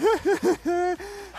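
high wheezy cackle; mad scientist laugh. Acted. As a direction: cackle at the dark joke. Joke about being mugged.

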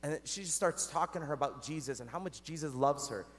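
ordinary delivery

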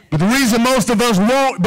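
ordinary talk, nothing marked

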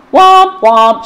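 loud, heavy tuba womp. Acted. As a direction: puff it out like a tuba. Tuba sound again.

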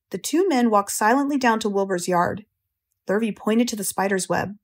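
ordinary delivery

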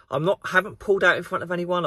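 normal talking voice

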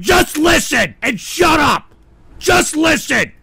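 exasperated shout. Real, not acted. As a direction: order them to pipe down. Host scolds chat over interview.

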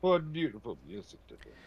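soft Dracula quote. Acted. Friend echoes Dracula quote softly.

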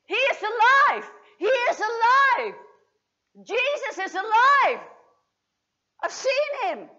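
loud, high, ecstatic shouting; high loud triumphant cry; joyful shout. Acted. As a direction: burst in shouting the news. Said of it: "I burst in and I shouted".

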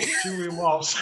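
awkward laughing repeat. Retold or imitated. Repeating his name on the phone.

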